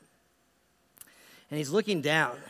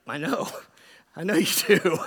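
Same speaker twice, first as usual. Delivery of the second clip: amused; 5 dB louder than usual. Retold or imitated. Amused reply to friend's confession.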